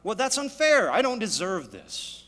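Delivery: loud, high, aggrieved protest to God; indignant complaint. Retold or imitated. A believer protests hardship to God, loud and aggrieved. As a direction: whine it out, offended and entitled. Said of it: "we might say to God".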